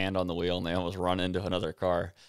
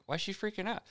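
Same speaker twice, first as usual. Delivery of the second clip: high, softer baffled question; mock bewilderment in a raised, lighter voice; puzzled reaction; 13 semitones higher, 6 dB quieter, faster than usual. Viewer wondering at the teacher's reaction, puzzled aside.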